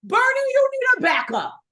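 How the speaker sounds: very high, loud plea; high-pitched insistent shout; exasperated yell. Pretend. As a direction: holler it as if he won't listen. Urging Bernie, louder repeat.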